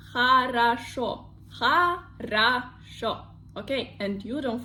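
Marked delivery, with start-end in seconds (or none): 0.1-3.3 хорошо spelt out as ha-ra-SHO; emphatic model pronunciation. Shown as a demonstration. Teacher drilling learners, хорошо deliberately as ha-ra-SHO. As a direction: say хорошо carefully, syllable by syllable, "ah" twice. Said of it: "So I say хорошо".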